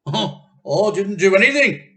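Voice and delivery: high and loud, a touch of innocent denial; loud protest. Acted. Husband denying to his wife, loud and high. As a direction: protest your innocence loudly.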